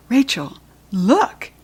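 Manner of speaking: a small lift of eager excitement; mildly thrilled, spotting the golden light; sudden excitement. A narrator's reading. Kirsty pointing out the glowing light to Rachel, mildly excited. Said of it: "said Kirsty in sudden excitement".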